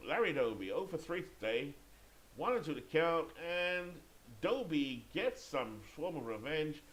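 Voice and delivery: lively baseball commentary, key words stretched; play-by-play. Pretend. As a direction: carry the crowd through the base hit. Live play-by-play call.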